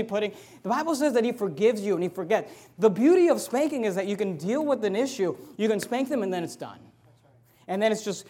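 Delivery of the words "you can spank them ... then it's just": ordinary delivery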